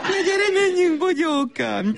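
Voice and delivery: put-on high sing-song coo to a toddler; mother's high baby-talk voice.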